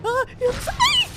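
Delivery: very high loud yelp of sorry; panicked apology. Real, not acted. Scared apology while chased.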